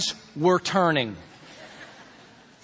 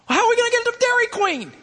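put-on much higher, fast, louder child's whine; whiny kid; 13 semitones higher, 4 dB louder, faster than usual. Little boy pleading with his dad about Dairy Queen, whiny. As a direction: whine like a kid denied ice cream.

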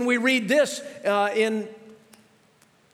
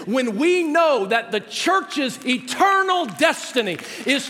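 impassioned preaching; 3 semitones higher than usual. Acted. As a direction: preach it with growing fire. Climactic preaching passage.

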